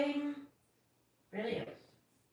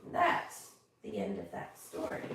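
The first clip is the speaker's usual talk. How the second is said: usual speaking voice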